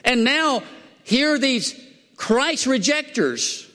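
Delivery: high, driving preacher's delivery; fired-up preaching. Real, not acted. Preacher setting saved people against rejecters, fired-up preaching. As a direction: preach it high, stretching the contrasted groups.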